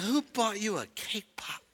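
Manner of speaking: very soft, slow question; a mother's hushed, patient prompting; calm questioning mom. Retold or imitated. Preacher plays mom questioning her toddler, hushed and slow.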